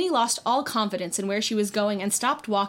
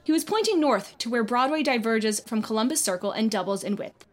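plain reading voice

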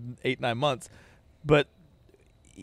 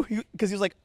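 usual speaking voice

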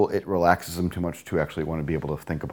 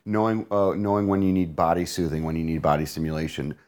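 usual speaking voice

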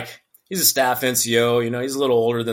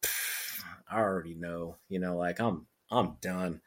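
resigned mumble; 6 semitones lower, 13 dB quieter than usual. Retold or imitated. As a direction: sigh it out like you've given up. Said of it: "I'm like".

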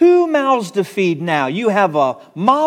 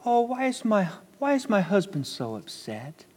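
softer, sweet mock-caring wife; mock gentle concern; 9 dB quieter than usual. Pastor shows the gentle reading he rejects, softly sweet. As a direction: coo it like a falsely gentle wife. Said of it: "it's not a gentle".

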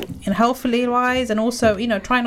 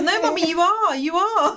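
put-on higher-pitched child's insistence; lightly voiced daughter, pitched up; insistent daughter; 7 semitones higher, slower than usual. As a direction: mimic a little girl contradicting mum. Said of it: "She goes".

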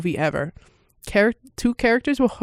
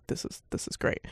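whispered, breathy awe; whispered retold praise; whispered reaction; 13 dB quieter than usual. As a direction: whisper it in quiet delight. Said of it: "but I was like".

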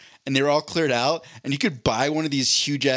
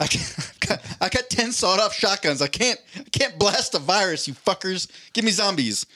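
mock Montanan accent; 5 semitones higher than usual. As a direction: play a hammed-up Montanan prepper accent. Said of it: "I give them that accent".

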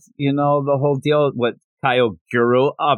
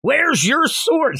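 mock angry sword maker; 13 semitones higher, much slower than usual. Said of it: "flips out".

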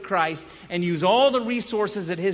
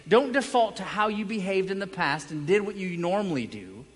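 higher, louder sermon push; urgent pulpit exhortation; preaching; 5 semitones higher, 4 dB louder than usual. Acted. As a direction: drive the warning home to the congregation. Preaching builds again.

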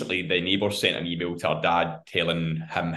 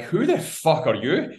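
put-on slow, higher drunken slur; drunk girl; 8 semitones higher, slower than usual. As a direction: sound properly drunk and rude. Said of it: "Steaming, obviously".

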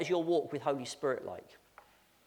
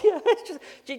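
laughing; 10 dB louder than usual. Real, not acted. Laughing while recalling the deal.

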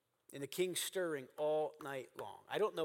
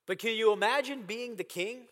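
impassioned preaching; 5 semitones higher, 8 dB louder than usual. Real, not acted. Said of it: "preaching at full force".

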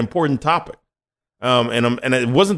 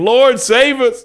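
high, slow desperate plea; begging; 10 semitones higher, 5 dB louder, slower than usual. Retold or imitated. Israelites cornered by the army beg the Lord, high slow plea. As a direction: cry out to the Lord for rescue. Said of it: "They're begging".

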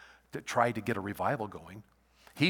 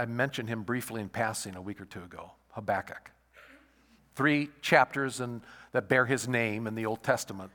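usual speaking voice

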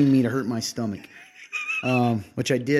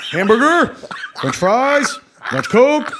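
higher and louder with each item; rising kingly order; 11 semitones higher, 8 dB louder, slower than usual. Retold or imitated. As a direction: climb up through the order. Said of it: "It always goes up".

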